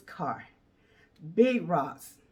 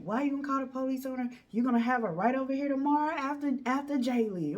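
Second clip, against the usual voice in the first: knowing friend; 6 semitones higher, faster than usual. Retold or imitated. Friend explaining, quick.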